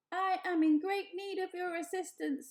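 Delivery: bright, even robot voice; friendly robot voice. A narrator's reading. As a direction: speak as a kindly robot. Roz the robot speaks.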